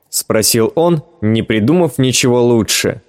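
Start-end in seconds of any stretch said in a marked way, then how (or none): none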